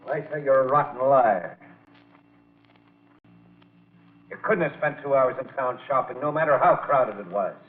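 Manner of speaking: controlled, accusing interrogation; jealous accusation. Acted. Jealous husband questions wife about town trip, accusing. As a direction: grill her like a cheated husband.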